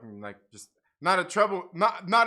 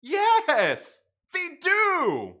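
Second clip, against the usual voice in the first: excited shout; 9 semitones higher, 9 dB louder, much slower than usual. Real, not acted. Excited agreement with chat.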